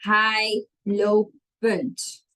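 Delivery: "hij lopend" slowly, word by word, lo-pend split; very slow "hij lopend", syllables apart; wrong form, sounded out. Shown as a demonstration. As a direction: spell out the mistake "hij lopend" in separate beats. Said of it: "It's not".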